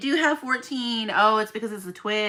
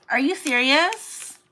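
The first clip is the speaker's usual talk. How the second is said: gasp, then slow, higher and louder; shocked; 6 semitones higher, 6 dB louder, slower than usual. Real, not acted. Asked about her collection, gasps in disbelief. Viewer question surprises her.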